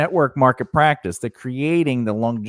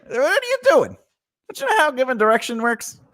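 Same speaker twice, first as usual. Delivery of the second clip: high mock scolding; 13 semitones higher than usual.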